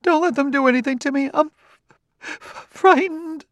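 very high, desperate woman's outburst; tearful and frightened. A narrator's reading. Wife begging her husband to protect her, frightened. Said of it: "let her cry".

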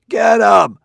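loud high shout from the back; rally the mob with a shout; yell. A narrator's reading. Man in the crowd yelling to rush him. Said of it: "Someone well in the back yelled".

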